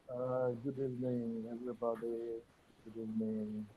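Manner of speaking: barely audible, far from mic; weak signal on a call; faint mic. Real, not acted. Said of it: "We cannot hear you".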